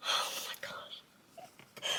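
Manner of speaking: breathless, whispered oh my gosh; a real whisper, moved almost to tears; overwhelmed whisper. Real, not acted. Reviewer whispering to viewers, overcome by the book, softly.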